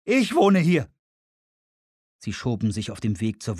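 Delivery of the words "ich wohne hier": lauter, hoher Protest; klagend. Acted. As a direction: beklag dich laut und hoch. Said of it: "sagte Richard in klagendem Ton". (klagend, laut und hoch)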